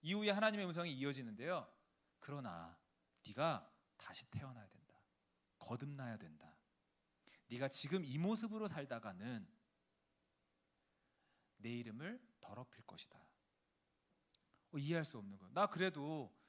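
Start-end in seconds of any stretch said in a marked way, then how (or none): 2.2-13.2 low, hushed solemn warning; a near-whispered divine rebuke; voice of God. Acted. God warning the missionary wife, low and hushed.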